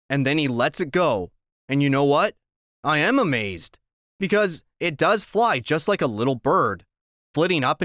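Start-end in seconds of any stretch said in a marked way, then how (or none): none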